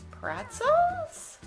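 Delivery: high, rising puzzled question. A narrator's reading. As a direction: ask it in baffled disbelief, pitch way up. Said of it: "Pete and the gang were puzzled".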